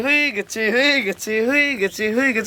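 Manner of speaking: high, singsong repeated cheer; gleeful celebrating. Acted. Customer whose form is done to his friends, gleeful celebrating. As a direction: crow with delight in a high voice.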